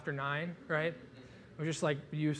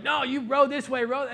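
high, loud mock shout at fellow rowers; hammed-up yell at the crew in the boat; 9 semitones higher, 7 dB louder than usual. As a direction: bark it like an angry oarsman. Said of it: "like, yelling at each other".

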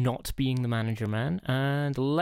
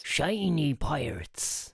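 slow drawn-out pirate voice; much slower than usual. Pretend. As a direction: stretch it like a pirate. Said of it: "I will drop my pirate voice".

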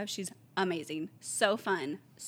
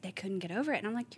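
ordinary talk, nothing marked

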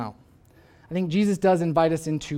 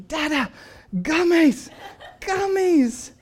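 high, laughing mock-wail of a small child; wailing toddler plea; 10 semitones higher, 4 dB louder, much slower than usual. Son pleading with dad for gummies, high mock wail. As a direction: whine it like a kid in tears, half laughing. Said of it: "He is pleading with me".

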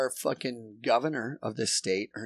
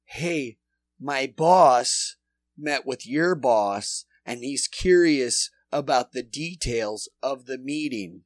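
reading the corrupt email out loud, louder and slow in places; mock email voice; 3 semitones higher, 7 dB louder, slower than usual. Mocking the governor's staff email to his friend, louder. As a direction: sneer out the crook's email. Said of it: "There's emails that says".

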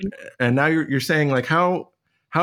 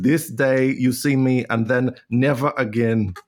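slow, solemn put-on Austrian accent; botched Austrian accent. Martin Luther at his farewell supper, wandering Austrian accent. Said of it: "he got a new accent".

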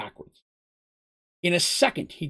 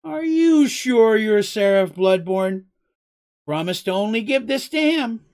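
slow, loud, suspicious character voice, pitched up; shrewd questioning; 4 semitones higher, 5 dB louder, slower than usual. A narrator's reading. Mugen checks Seraph's identity before handing over, shrewdly. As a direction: draw the question out to test him. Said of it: "his eyes narrowed shrewdly".

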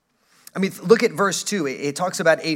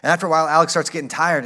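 usual speaking voice

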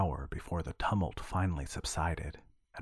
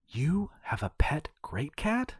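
slow, higher amazed exclamation; exclaimed; 8 semitones higher than usual. A narrator's reading. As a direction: gasp it out in wonder. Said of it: "Cassandra exclaimed".

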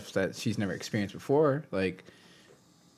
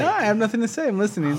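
mock innocent; 11 semitones higher, 5 dB louder, faster than usual.

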